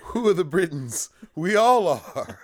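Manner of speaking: high, loud laughing peasant voice; mock peasant. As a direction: play the indignant peasant, laughing. Imitating peasants from the film.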